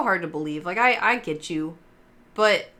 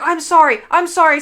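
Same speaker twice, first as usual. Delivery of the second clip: much higher, much louder pleading; retold frantic apology; 9 semitones higher, 11 dB louder than usual. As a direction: beg the ghost for forgiveness. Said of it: "she freaked the fuck out".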